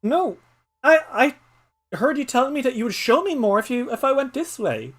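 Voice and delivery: put-on high, hurried child explaining; young Patter. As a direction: protest innocently. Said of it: "poor Patter's like".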